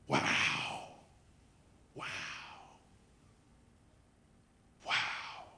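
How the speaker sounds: hushed, drawn-out wonder; awed whisper. Real, not acted. Preacher marvelling at Jesus on the cross, whispered awe. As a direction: breathe each one out in awe, with long pauses.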